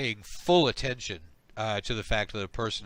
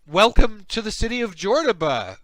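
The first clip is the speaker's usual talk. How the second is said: high, loud, gushing greeting; effusive welcome; 6 semitones higher, 9 dB louder than usual. Acted. Slug welcoming a were-slug to the city, effusive welcome. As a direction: greet an honoured guest with swooping, raised pitch.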